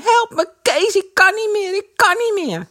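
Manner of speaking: put-on high, loud cry of a boy sinking through the ice; desperate cry for help. A narrator's reading. Drowning Eddy cries to Kees for help, high panicked shout. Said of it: "Hij hoorde Eddy roepen".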